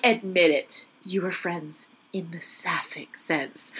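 slow, hushed mock interrogation; lightly acted detective pressing for a confession; cornering interrogator. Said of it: "like the Angela Lansbury of, like, cornering someone".

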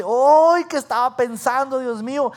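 loud, high "ay" easing off; acted regretful wail. Husband regretting the fight with his wife, wailing. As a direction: lament it theatrically, starting big. Acts out regret after quarrel.

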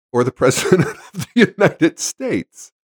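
laughter breaking into the words; real laughing. Real, not acted. Joking about the president, unable to stop laughing. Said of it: "I've gotta stop laughing about this".

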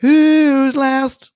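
high, loud drawn-out call. Pretend. Host summoning the last listener review, calling out. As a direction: belt it out like a show host.

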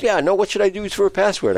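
a son losing patience with his dad; kid's exasperated voice. Retold or imitated. Son asks dad for a password, exasperated. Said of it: "He goes".

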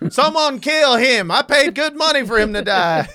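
laughing mock Southern spectator; Southern accent. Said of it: "hearing my accent in two different people".

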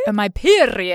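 put-on lightly pompous made-up accent; pompous Renaissance woman. Co-host copying her friend's fancy way, pompous accent. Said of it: "sound like a pompous Renaissance woman".

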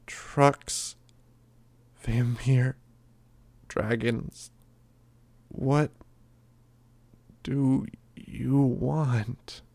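high, drawn-out whispering taunt; eerie whisper. A narrator's reading. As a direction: whisper the choices slowly and creepily. Narrator voices Grey Boy taunting.

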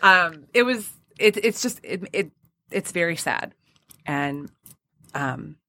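softening and fading as tears come; holding back tears. Real, not acted. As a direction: push through the words, close to crying. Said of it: "I'm trying not to cry right now".

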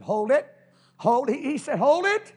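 high, loud hold it; the feast governor calls out in amazement; shouting stop. Retold or imitated. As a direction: stop the servants with a sharp cry. Governor stopping the servants.

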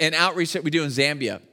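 ordinary talk, nothing marked

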